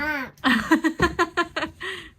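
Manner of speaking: high rippling giggle; amused laugh. Real, not acted. Mother amused by toddler's answer, giggling. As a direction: burst into a long, light laugh.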